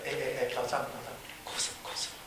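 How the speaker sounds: very soft, low, careful question; acted tentative, quiet inquiry; hushed concern. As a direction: gently check on a friend, barely voiced. Friend gently approaches crying roommate.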